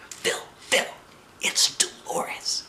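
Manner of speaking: slow urgent whisper. A narrator's reading. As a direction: whisper his name to get his attention. Secretly calling to her friend.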